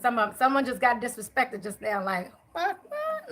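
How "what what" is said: high-pitched mock outrage; a spirit huffing in high-pitched shock; offended disbelief. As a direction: squeak it like an insulted spirit.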